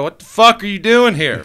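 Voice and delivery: startled retold exclamation; surprised outburst. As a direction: blurt it out in disbelief. Shocked reply at football game.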